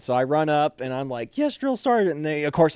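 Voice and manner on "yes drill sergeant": high-pitched recruit acknowledgement; dutiful response, retold; eager trainee reply. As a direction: answer smartly as you run up, acted out. Runs up and answers the sergeant.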